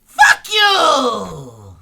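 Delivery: full-throated screamed insult; furious shout. Acted. Old lady yells at the paid giant, furious shout. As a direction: scream it at him with everything. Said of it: "She goes".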